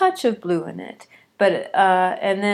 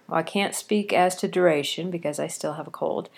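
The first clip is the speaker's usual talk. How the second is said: ordinary delivery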